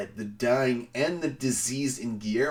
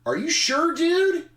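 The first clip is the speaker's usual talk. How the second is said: much higher, louder, doubtful voice; incredulous staff; 5 dB louder than usual. Retold or imitated. Staff doubting the manuscript, high incredulous voice. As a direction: squeak it out in disbelief. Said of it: "they're like".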